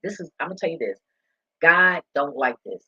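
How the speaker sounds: ordinary talk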